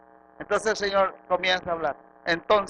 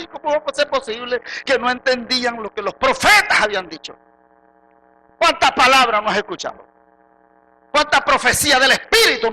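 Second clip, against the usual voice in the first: high, loud, impassioned preaching; prédica a toda voz; 9 semitones higher, 9 dB louder than usual. Real, not acted. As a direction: press the congregation with urgent loud questions. (predicación apasionada, en voz alta)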